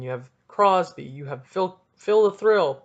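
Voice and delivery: ordinary delivery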